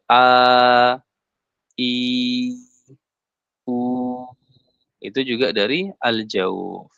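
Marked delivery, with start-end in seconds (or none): 0.1-3.8 A, I, U each drawn out long; long vowels. Shown as a demonstration. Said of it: "yang panjang juga". (a, i, u dibaca panjang)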